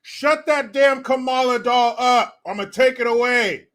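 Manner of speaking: loud, high, slowed scolding; yelling parent. Acted. Parent to kid about noisy doll, acted angry yell. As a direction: holler it like a fed-up parent. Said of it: "Parents would be like".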